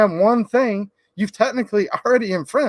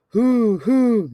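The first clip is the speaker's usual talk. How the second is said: owl hooting; 3 semitones higher than usual. Acted. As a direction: hoot like an owl. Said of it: "He can sound like an owl".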